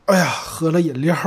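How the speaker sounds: talking through cola burps; hiccupy burps between words; burping after fizzy drink. Real, not acted. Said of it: "喝完了以后直打嗝". (喝完可乐边说边打嗝)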